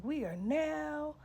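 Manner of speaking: slow, drawn-out stage announcer voice; mock announcer. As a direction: announce it like a grand Vegas host. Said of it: "you trying to be like".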